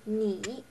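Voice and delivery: "ni" dipping then rising; third tone. Shown as a demonstration. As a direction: draw a check mark with the pitch on "ni". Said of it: "on the third tone".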